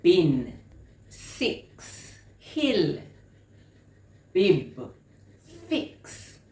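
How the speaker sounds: BIN, SIX, HILL, BIB, FIX as isolated words; short I listening drill. Shown as a demonstration. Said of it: "if they hear the I sound".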